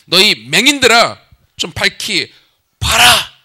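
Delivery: slow, loud, commanding call; loud divine call. Acted. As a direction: call out to the blind slowly and loudly. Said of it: "라고 말씀하고 있어요". (느리고 크게 꾸짖듯 부르기)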